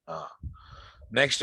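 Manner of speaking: usual speaking voice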